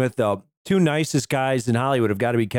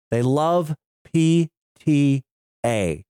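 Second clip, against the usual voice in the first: slow drawn-out reveal; much slower than usual. Acted. Dramatic reveal of podcast name.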